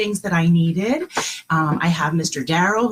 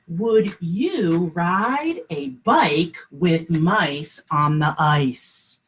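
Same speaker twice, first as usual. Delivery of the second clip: slow read-aloud; 3 semitones lower, much slower than usual. Shown as a demonstration. Teacher reading a secret message to her class, very slowly. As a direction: read each word slowly for little listeners. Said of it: "It says".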